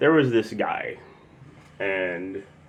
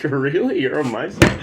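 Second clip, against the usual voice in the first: laughing disbelief; 6 semitones higher, 7 dB louder than usual. Retold or imitated. Retelling surprise, laughing.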